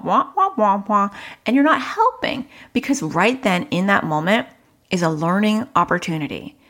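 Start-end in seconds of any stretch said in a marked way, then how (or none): 0.0-1.1 Charlie Brown adult wah. Retold or imitated. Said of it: "It's the Charlie Brown wah".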